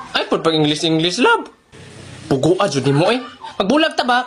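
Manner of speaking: ordinary delivery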